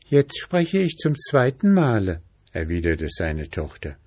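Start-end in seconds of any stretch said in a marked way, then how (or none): 0.1-2.2 put-on high, eerie girl's voice; eerie princess voice.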